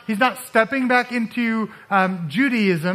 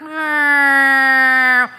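high, loud squeaky-toy meow; 4 semitones higher, 6 dB louder than usual. Retold or imitated. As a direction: squeeze out a toy's squeak as a meow. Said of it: "and make that sound".

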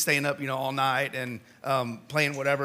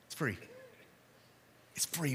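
soft emphasis; 2 semitones lower, 11 dB quieter than usual. Acted. Quiet sermon point after loud story.

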